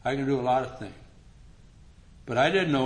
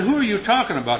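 high, loud baffled question; wife can't believe it; wife asking, high and loud; 10 semitones higher, 8 dB louder than usual. Retold or imitated.

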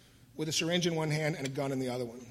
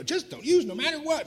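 high, sing-song mimicry of the advice; mocking AA advice; 11 semitones higher than usual. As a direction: parrot their empty advice back. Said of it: "and they'd go".

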